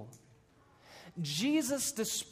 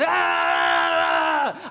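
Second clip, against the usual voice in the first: loud, deep frightened yell; a wild man's loud low scream; 9 dB louder than usual. Acted.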